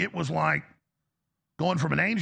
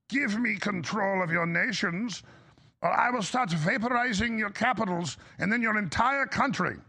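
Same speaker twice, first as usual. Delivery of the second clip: comic supervillain; 2 semitones higher than usual. Retold or imitated. Pundit mocking the president as a comic supervillain. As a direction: ham it up like a cartoon villain with destructo beams. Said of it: "sounding like a Marvel comic supervillain".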